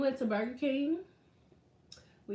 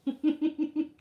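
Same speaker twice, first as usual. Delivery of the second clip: short laugh; 4 semitones higher, 4 dB louder than usual. Real, not acted. Laughing again.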